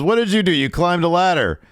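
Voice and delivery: high, loud belittling voice; mock scornful retort. Wrestler belittling the champion's win, high mock scorn.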